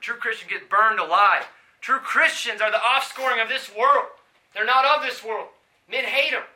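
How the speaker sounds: climbing loud and high, each line hammered; full-force preaching. Acted. Preacher telling listeners Christians are hated, at full force. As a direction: build the fire in every line.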